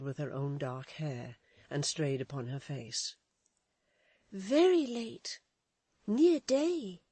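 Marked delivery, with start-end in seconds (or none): 4.3-7.0 put-on high, loud, slow girl's answer; girlish voice. Florence telling Edith the hour. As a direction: answer her gently but urgently.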